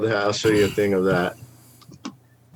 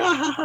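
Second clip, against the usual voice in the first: high mocking ha ha ha, much higher; mock laughter; much faster than usual. Coworkers laughing at the scared girl, high mock laugh.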